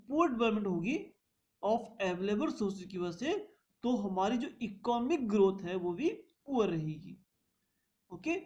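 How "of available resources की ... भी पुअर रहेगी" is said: usual speaking voice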